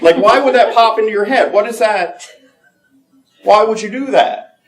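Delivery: high, loud incredulous questioning; mock bafflement. Questioning his own absurd intrusive thoughts, high-pitched mock bafflement. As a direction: pitch it way up, comically exasperated.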